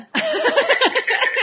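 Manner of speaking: loud, high laugh bursting out; burst of laughter. Real, not acted. Laughs at husband's turnaround.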